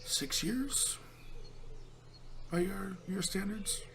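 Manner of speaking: ancient tree voice. Acted.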